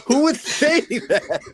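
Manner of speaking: high, slow incredulous laugh-question; mock disbelief. Real, not acted. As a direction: scoff at it in disbelief. Mocking who'd say it.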